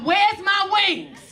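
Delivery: high, drawn-out mock scream; retold scream. Panicked passenger standing up in first class, mock screaming. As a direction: stretch out a mock panicked yell. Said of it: "started screaming".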